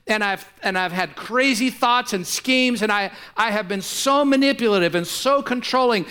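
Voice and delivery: pleading prayer building to a loud climb; preached prayer. Acted. Preacher voicing a sinner's prayer, rising to a loud plea. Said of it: "and says".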